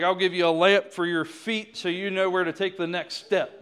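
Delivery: emphatic acted reply; insistent answer. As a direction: answer firmly and loudly, as God. Acts God's answer to his frustration.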